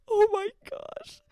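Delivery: high, loud laughing squeal; real laughing cry of oh my gosh. Real, not acted. Laughing at own whining.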